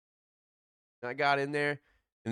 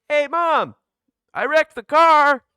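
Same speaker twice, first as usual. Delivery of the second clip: high, loud, slow call to mom; blurted confession; 12 semitones higher, 12 dB louder, slower than usual. Retold or imitated. Calling to mom from across the room, high and loud.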